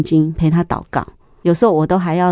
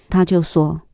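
ordinary talk

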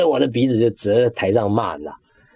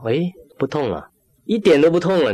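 amazed surprise; 6 semitones higher than usual. Real, not acted. Pain suddenly gone while praying.

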